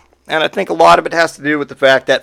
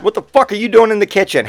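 higher-pitched, hurried incredulous question; mock bafflement; 7 semitones higher, faster than usual. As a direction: question the cat in mock disbelief. Acted startled question to cat.

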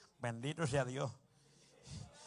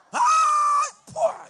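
very high, loud comic scream and splat; mock dying scream; 16 dB louder than usual. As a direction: scream then collapse, for laughs. Man dying in childbirth, mock.

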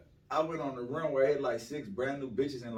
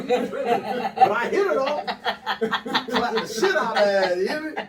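high, loud laughing brag; 8 semitones higher, 10 dB louder than usual. Real, not acted. Telling friends about her, laughing loud.

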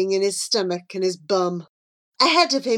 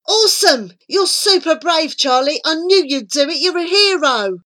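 high, loud, gushing encouragement; bubbly, emphatic enthusiasm; excited praise; 8 semitones higher, 5 dB louder than usual. A narrator's reading. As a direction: cheer on a friend eagerly. Flora praises Charlie for agreeing.

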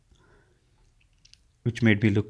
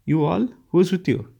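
pitched up, pressing demand; 8 semitones higher than usual. A narrator's reading. Narrator demanding to know who is upstairs, pitched-up insistence. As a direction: insist on an answer right now. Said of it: "I demanded".

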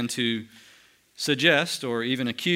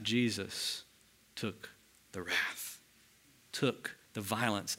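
hushed preaching; 4 semitones lower, 10 dB quieter, slower than usual. Real, not acted. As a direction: drop right down to a near hush.

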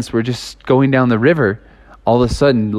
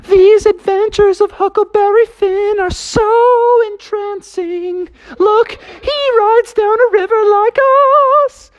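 much higher, slow, enraptured drawl; dreamy Justin; slower than usual. Retold or imitated. Justin marvels at Huckleberry Finn, high and slow. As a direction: savour every word like you're lost in the book. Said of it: "Justin was like".

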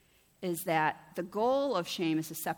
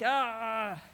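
frustrated groan; 3 semitones higher, 3 dB louder than usual. Acted. Frustrated groan after family fight.

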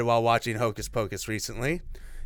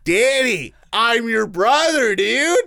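louder, higher, slow mock protest; mock brother reply; 7 semitones higher, 6 dB louder, slower than usual. Brother rejecting his sister, mild mock loud protest. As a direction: push her off, hammed up. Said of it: "Danny's into her older brother".

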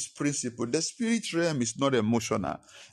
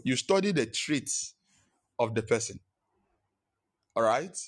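ordinary talk

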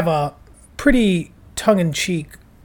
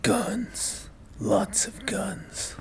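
hushed, slow action-hero impression; John Wick; 4 semitones higher, 12 dB quieter, much slower than usual. Host quoting John Wick to co-host, hushed impression. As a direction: drop to a near-whisper, drawing it out. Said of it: "is how he talks in John Wick".

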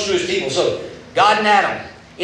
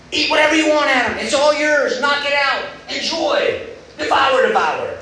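high and eager, pressing Adam to eat; excited invitation; 9 semitones higher than usual. Retold or imitated. Preacher voicing God urging Adam to eat everything, excited. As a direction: urge him on like an overjoyed host. God voiced offering the garden.

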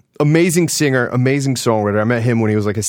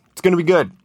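ordinary talk

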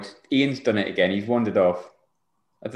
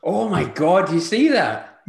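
amazed; 5 semitones higher, 4 dB louder, slower than usual. Real, not acted. Shocked reaction to manager clip.

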